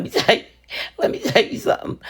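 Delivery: high, breathy, fast through laughter; laughing through words. Real, not acted. Crafter cracking up mid-sentence, laughing through it. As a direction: giggle the words out quickly. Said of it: "I know I'm laughing".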